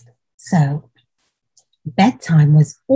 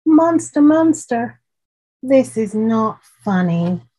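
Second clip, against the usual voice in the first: scared call; 4 semitones higher than usual. Acted. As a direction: call out, frightened and annoyed. Scared child calling.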